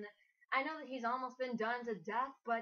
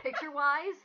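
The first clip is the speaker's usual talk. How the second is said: ordinary talk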